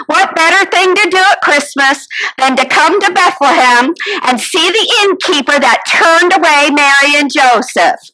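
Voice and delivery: ordinary talk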